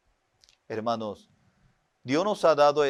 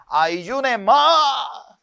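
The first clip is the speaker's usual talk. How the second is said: higher, louder order from the pastor; stern pastor; 8 semitones higher, 5 dB louder than usual. Retold or imitated. Preacher imitating his old pastor ordering more fasting, stern. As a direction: bark it like a demanding pastor.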